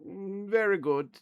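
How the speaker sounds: high, put-on German villain voice; German evil scientist accent. GM voicing a German evil scientist over the intercom. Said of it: "when I do my accents".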